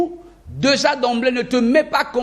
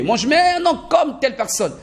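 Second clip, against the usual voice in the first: higher-pitched mock learner voice; raised-pitch imitation of a stubborn learner; 7 semitones higher than usual. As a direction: lightly put on a beginner's wishful insistence. Acts learner wanting to copy someone.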